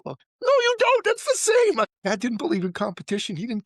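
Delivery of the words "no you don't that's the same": much higher, louder mocking mom voice; mom protesting. Son voicing mom's shrill objection to dad, much higher and louder. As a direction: squeal her objection back at him.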